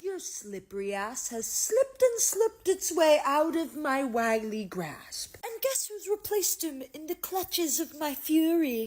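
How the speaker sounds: slow, theatrical villain rant; hammed-up, drawn-out menace; mock villain fury. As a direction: savour each word like a scheming villain.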